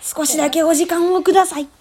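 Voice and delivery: high, polite campaign plea; rally speech. Pretend. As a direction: ask the crowd for a moment like a candidate. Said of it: "街頭演説したい". (選挙演説風の丁寧な声)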